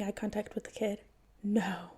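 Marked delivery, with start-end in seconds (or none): none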